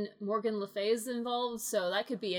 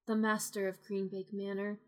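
ordinary delivery

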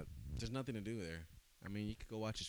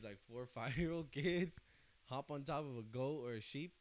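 laughing through the words; 6 semitones higher than usual. Real, not acted. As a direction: giggle while telling it. Laughing while telling the sheep story.

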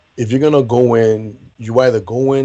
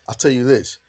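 normal talking voice

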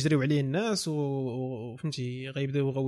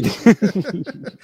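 loud laugh; 4 semitones higher, 9 dB louder than usual. Real, not acted. Laughs at friend's word choice.

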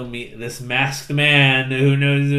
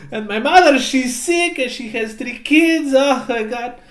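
put-on much higher and louder pleading with a Latin accent; hammed-up cat begging for his life, slowing at the end; 11 semitones higher, 5 dB louder than usual. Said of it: "I kept trying to do a Latin accent".

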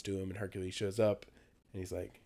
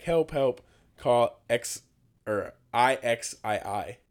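mock cry for help; 3 semitones higher, 7 dB louder, slower than usual. Kids calling for help.

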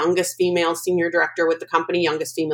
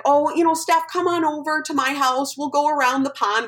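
encouraging mom; 8 semitones higher than usual. Retold or imitated. Acting out her encouraging mom.

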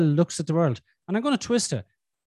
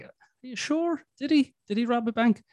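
put-on incredulous child voice; 5 semitones higher, 3 dB quieter than usual. Son's disbelief voiced.

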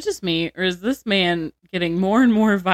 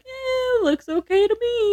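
high, dismissive doctor's shrug; mock careless doctor. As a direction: brush it off from far away. Doctor voiced from far back.

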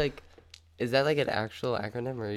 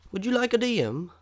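mock salesman; 10 semitones higher, 4 dB louder, faster than usual. Acted offer of dragon massage.

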